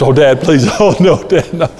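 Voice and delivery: mock pleading child. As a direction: imitate a kid begging Dad, half-laughing. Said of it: "he would just start pleading for his life".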